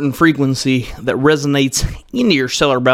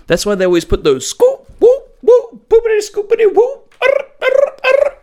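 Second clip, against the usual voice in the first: very high, loud whoops and scoops; vocal ad-libs; 4 dB louder than usual. Shown as a demonstration. Showing the crowd the whoops singers put in songs, hammed-up. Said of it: "in songs".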